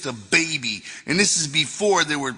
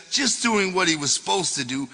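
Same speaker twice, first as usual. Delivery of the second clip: ordinary delivery, nothing marked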